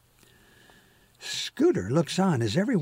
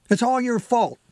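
a higher, louder angry snap at his dad; snapping; 9 semitones higher, 5 dB louder than usual. A narrator's reading. Johnny blaming his dad, a sharp higher snap. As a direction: lash out, blaming. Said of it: "he snapped".

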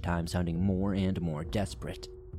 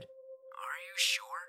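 slow, high whispered question; softly doubt him over the radio; drawn-out, whispery questioning; eerie radio voice; 8 semitones higher, 10 dB quieter, much slower than usual. A narrator's reading.

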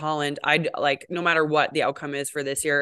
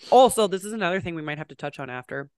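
ordinary talk

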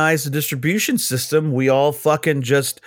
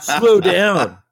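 louder, emphatic slow down; firm louder repeat; 4 semitones higher, 4 dB louder than usual. Real, not acted. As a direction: put the brakes on, loudly. Repeats it more firmly.

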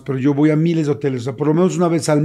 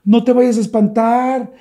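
high, raised voice of a partner; imitated calling out; 8 semitones higher, 4 dB louder than usual. Partner warning him as she comes upstairs, high calling voice. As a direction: act out shouting ahead from afar. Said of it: "era ella gritando".

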